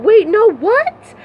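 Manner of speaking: high-pitched acted wail of disbelief; retold crying panic. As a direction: re-enact being devastated and pleading in disbelief. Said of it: "I'm crying".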